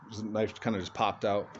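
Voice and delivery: usual speaking voice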